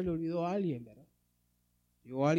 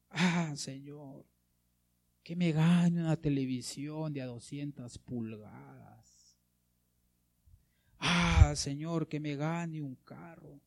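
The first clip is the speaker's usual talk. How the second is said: mock wishful prayer; slower than usual. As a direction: pretend to pray longingly for a prize. Mock prayer for material things.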